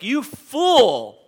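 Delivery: "You fool." thrown out loud and high, "fool" falling hard; real emphatic. Shown as a demonstration. Pastor showing the congregation how God shouts the rebuke, loud and emphatic. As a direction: bellow "You fool" like a verdict from heaven. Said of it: "And it's real emphatic".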